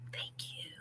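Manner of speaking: soft unvoiced whisper of thanks; quiet whispered thank-you. Real, not acted. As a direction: breathe the thanks out under your voice. Whispered aside to team.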